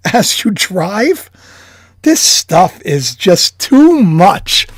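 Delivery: normal talking voice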